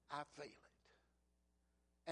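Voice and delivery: slow breathy whisper, drawn out; preacher quoting the hymn to his congregation, whispered; whispered slowly. Real, not acted. Slow hushed whisper.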